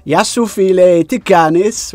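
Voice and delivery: high, loud, slowed-out Greek hello; bold, drawn-out tourist Greek; very confident greeting. Retold or imitated. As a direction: greet the border officer with full bluffing confidence. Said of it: "So I very confidently went up".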